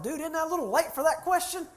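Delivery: high, loud mock disbelief; can't believe he just asked that; incredulous. Incredulous thought about the groom's question.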